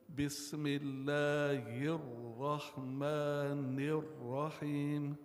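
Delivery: tajweed chanting with stressed words; recitation with emphasis. Shown as a demonstration. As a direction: chant with الله and الرحمن weighted. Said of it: "try to emphasize on those places".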